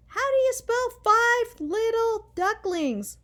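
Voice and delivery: high, loud, slow call across the house; child calling loudly to mom. A narrator's reading. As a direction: call out to the kitchen.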